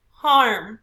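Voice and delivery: HARM pushed out loud; stress on HARM. Shown as a demonstration. Teacher reminding class how harm sounds, stressed. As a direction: raise the voice on "harm". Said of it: "We have to raise our voice".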